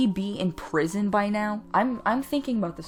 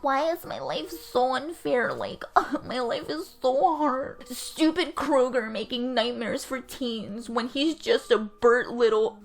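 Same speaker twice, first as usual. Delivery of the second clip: high, put-on emo teen whine; mock a self-pitying teenager; exaggerated high teenage complaint; whiny teenager; 5 semitones higher than usual. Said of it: "Jason being like".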